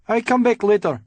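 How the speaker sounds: put-on frightened cleaner, high, loud Eastern European accent; thick Eastern European accent. A narrator's reading. Startled office cleaner excusing himself, thick Eastern European accent. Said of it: "said in a thick accent from Eastern Europe".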